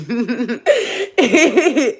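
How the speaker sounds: a bright burst of open laughter; hearty cackle; loud laugh. Real, not acted. Laugh after joke.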